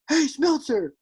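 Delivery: high, jeering heckler voice. Retold or imitated. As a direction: taunt the pitcher from the stands. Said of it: "all I hear is".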